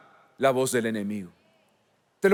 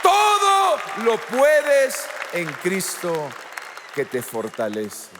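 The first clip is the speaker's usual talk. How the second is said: rousing proclamation; 2 semitones lower, slower than usual. Acted. Preacher proclaiming God's promise to the congregation, rousing proclamation. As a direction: proclaim it slowly, then let it fall away.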